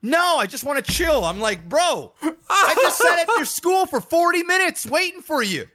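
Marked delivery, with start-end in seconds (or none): 0.0-1.2 high, lightly voiced kid refusing; whiny kid. Son refusing his dad's hug, high whiny kid voice. 1.7-5.7 high, laughing exasperation; mock outrage through laughter; exasperated laugh. Dad telling his son he waited forty minutes, laughing exasperation.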